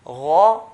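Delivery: Gha with a full, round mouth; heavy gha. Shown as a demonstration. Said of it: "Ghain has to be heavy".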